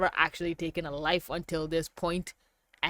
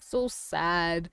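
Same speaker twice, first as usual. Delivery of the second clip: ordinary delivery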